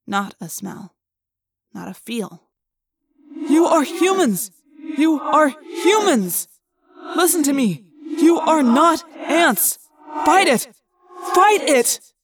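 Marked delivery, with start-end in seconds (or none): 3.3-12.1 desperate shouting. Acted. Desperate shouting at ant minds.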